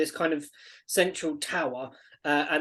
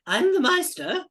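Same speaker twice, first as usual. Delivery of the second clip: put-on high-pitched eccentric old man introducing himself; excitable old Meister; 12 semitones higher, 4 dB louder than usual. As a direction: announce the title proudly in character. Game master plays the old man.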